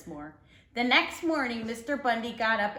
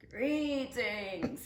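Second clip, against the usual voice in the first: raspy, barky; 5 dB quieter than usual. Shown as a demonstration. Hairy bearded man greets a passing cyclist, raspy. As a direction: rasp the greeting out slowly. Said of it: "so I'm gonna try right now".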